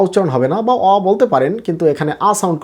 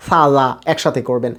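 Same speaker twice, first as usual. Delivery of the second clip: normal talking voice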